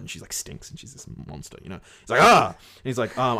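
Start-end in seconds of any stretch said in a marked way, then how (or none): 2.2-2.5 short high acted yelp; mock fright, sudden high "ah"; startled cry. Acts prince startled by monster.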